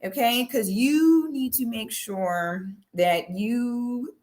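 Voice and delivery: slow, teasing Baltimore accent imitation. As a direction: exaggerate a thick Baltimore accent, stretching the vowels. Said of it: "everyone from Baltimore got that thick accent".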